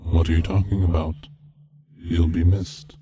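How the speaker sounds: plain reading voice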